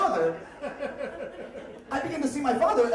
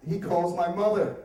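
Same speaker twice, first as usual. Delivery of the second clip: normal talking voice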